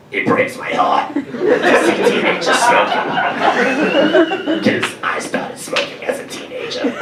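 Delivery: mock anti-smoking ad lady; imitating the ad lady. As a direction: croak it like a sad old smoker. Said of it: "She sounds like the lady from SpongeBob".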